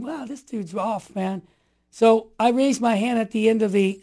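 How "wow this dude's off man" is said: a soft, slow under-the-breath aside; muttered thought. Retold or imitated. Young man listening to the bishop, muttering quietly. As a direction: mutter it to yourself, unimpressed. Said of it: "And I'm looking going".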